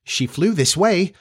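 puzzled and uneasy, voice lifted; a touch of troubled insistence. Acted. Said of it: "becoming troubled".

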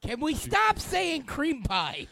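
exasperated. Real, not acted. Exasperated plea.

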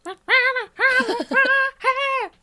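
high wordless screech imitating a singer; mock rock-singer screech. Answering what the rock singer sounds like talking, screech. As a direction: squeal it way up high. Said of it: "what does he sound like when he talks".

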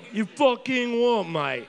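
put-on slow, cocky British accent. Teasing the quizmaster in a British accent. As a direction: drawl it like a London geezer, British accent. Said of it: "British accent".

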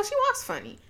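high, soft, offhand delivery; offhand remark. Retold or imitated. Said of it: "I said it just like that".

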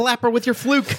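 high squeaky egging on; a fan egging on the mermaid, high and squeaky; mock cheering. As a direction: yell encouragement at the screen in a high voice.

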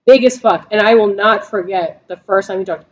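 ordinary talk, nothing marked

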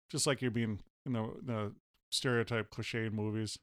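normal talking voice

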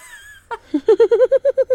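loud, high shriek of laughter; real helpless cackle. Real, not acted. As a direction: burst out laughing at your own line. Corpsing at rude phrase.

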